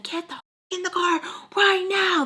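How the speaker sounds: high-pitched imitated yelled order; angry yell. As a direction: act out him ordering someone into the car. Drunk man yelling during car fight.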